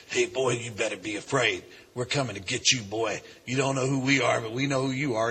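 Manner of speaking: low, menacing put-on voice; disguised threatening voice. Klansman phoning the preacher to scare him, disguised menace. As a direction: imitate a disguised caller, dropped low and kept controlled. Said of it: "disguised my voice".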